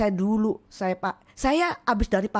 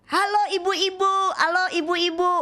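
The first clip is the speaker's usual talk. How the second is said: bright, much higher sing-song hello; cheerful greeting; 8 semitones higher than usual. Retold or imitated. Preacher greeting the mothers at school, much higher and bright. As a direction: call out warmly to the group of ladies.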